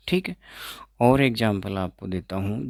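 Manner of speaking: normal talking voice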